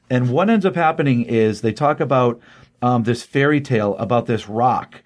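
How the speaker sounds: usual speaking voice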